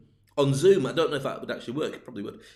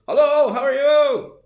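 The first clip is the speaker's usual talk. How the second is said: high, loud, drawn-out call; loud hailer; 11 semitones higher, 11 dB louder, slower than usual. Acted. Guide hailing socially distanced tourists, loud hailer call. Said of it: "one of those loud hailers".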